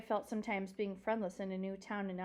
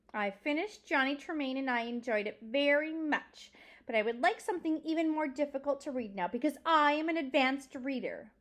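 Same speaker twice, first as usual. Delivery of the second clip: higher, emphatic show-off voice; self-important girl; 5 semitones higher, 5 dB louder than usual. Acted. Pinch-faced girl telling the librarian she reads advanced books, boastful. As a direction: boast with heavy stress on key words.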